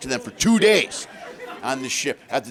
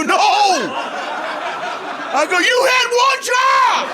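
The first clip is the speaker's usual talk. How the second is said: high, loud, slow despairing yell; frantic shout; 5 dB louder, much slower than usual. Retold or imitated. Father yelling at his daughters for missing his show, frantic and high. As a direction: scream it like the world just ended. Dad waking late, shouting.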